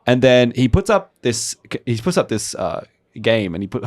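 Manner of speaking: ordinary talk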